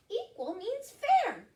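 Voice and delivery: high, slow mouse voice; high, loud little mouse; explaining mouse. Acted. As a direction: explain it carefully in a tiny squeak. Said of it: "explained Mouse".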